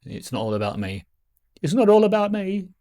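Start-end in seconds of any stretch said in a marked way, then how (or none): 1.6-2.7 high, loud, playful repeat; mock self-important outburst. Reminding viewers it's about them, high mock outburst. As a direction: belt it out high in mock protest.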